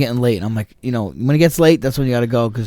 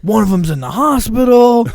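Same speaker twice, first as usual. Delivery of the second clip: loud, high disbelief; high alarmed news; 11 semitones higher, 6 dB louder than usual. Retold or imitated. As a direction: deliver the bombshell. Cop buddy's alarming news.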